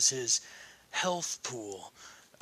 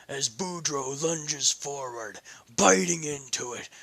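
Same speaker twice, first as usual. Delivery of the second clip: plain reading voice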